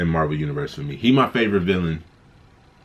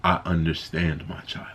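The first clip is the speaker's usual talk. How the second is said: soft whisper; 3 semitones lower, 6 dB quieter than usual. Retold or imitated. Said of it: "He was like".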